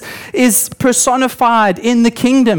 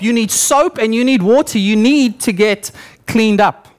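ordinary delivery

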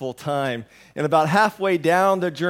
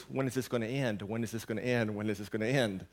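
lightly imitated kids asking on a loop, soft and low; quiet, low mock nagging; nagging repeated question; 5 semitones lower, 8 dB quieter, faster than usual. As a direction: repeat it under your breath like a pestering child. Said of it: "same question over and over and over".

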